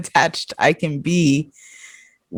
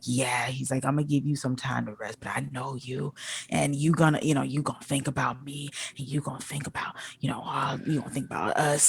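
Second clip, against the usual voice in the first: much softer, low, coaxing imitation; mock manipulative murmur; 5 semitones lower, 12 dB quieter than usual. Mocking the man's manipulative pitch, soft and low. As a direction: purr it like a smug manipulator. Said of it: "started getting extra manipulative".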